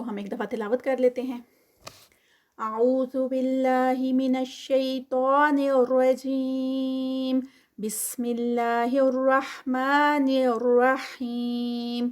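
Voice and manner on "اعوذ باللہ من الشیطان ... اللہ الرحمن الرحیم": slow, melodic chanted recitation; Quran recitation. Acted. Teacher reciting the whole passage for students, slow and melodic. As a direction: draw each phrase out in measured chant.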